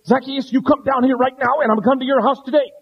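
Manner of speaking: loud, high, fast stern order; harsh command. Shown as a demonstration. Preacher mocks a harsh Jesus calling Zacchaeus, loud impatient command. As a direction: bark it like an impatient scold. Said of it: "Now, did he say it this way?".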